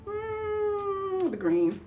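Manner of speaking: high, loud mock-wise pronouncement; pretend oracle. A girl playing oracle for the neighborhood kids, high mock-solemn. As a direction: ham up the all-knowing oracle. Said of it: "but I would pretend like".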